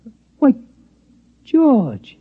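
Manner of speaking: can't believe it's his friend; surprised. Acted. Watchman spots George the mouse again, astonished.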